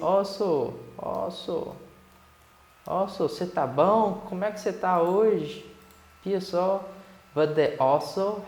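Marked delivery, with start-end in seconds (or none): 0.0-6.8 playful, higher-pitched Mineiro imitation; Mineiro accent. Teacher likening "also" to Minas talk, playful Mineiro accent. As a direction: put on a sing-song Minas Gerais accent. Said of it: "é tipo mineirês".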